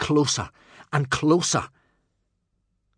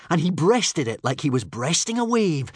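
plain reading voice

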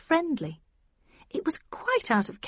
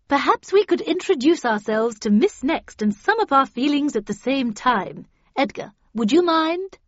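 higher, louder, lilting character voice; bright-pitched, managing request; Miss Havisham chairing; 5 semitones higher, 6 dB louder than usual. A narrator's reading. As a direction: coax the group along like a counsellor. Voices Miss Havisham leading the group.

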